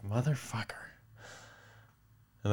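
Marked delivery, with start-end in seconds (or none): 0.0-0.9 voiced swear, a bit softer than usual; muttered swear. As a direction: grumble "Motherfucker." half aloud in disbelief. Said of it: "I was like".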